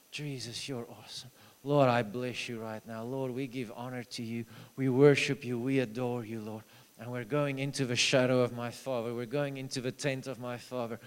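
soft, hushed prayer of adoration; quiet worship prayer. Retold or imitated. Preacher retelling his prayer over a sick girl, softly worshipful. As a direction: murmur praise to Jesus softly.

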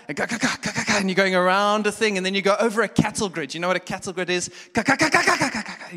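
loud, high rattling go go; bumpy-road go-go. Acted. Pastor imitating a car over a cattle grid, loud.

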